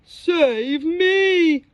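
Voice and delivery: a loud begging wail. Acted. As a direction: beg for rescue. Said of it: "begged Melon".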